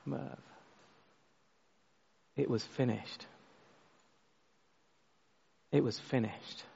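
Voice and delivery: very soft, low, restrained heartbreak; hushed retelling of a tearful plea, with long pauses; tearful cry, retold. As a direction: quietly act out a crushed grandad. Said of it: "he just burst into tears".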